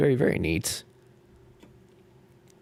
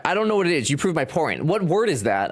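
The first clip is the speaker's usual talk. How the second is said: usual speaking voice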